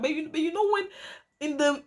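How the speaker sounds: ordinary talk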